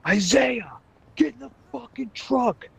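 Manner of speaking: retold screaming coach. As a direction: scream at him to get in the truck now. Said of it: "He's fucking screaming from".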